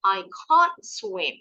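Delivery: "can't" as a long British kahnt, slow and high. Shown as a demonstration. Teacher models British "I can't swim" for the class. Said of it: "Kalau British English jelas perbedaannya".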